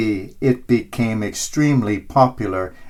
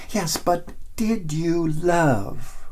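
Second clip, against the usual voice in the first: soft, slow and tender; gentle question; 4 semitones higher, 6 dB quieter, slower than usual. A narrator's reading. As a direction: ask it quietly, from the heart. Peter's gentle question at gate.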